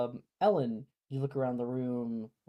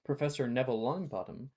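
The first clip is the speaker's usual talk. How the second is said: usual speaking voice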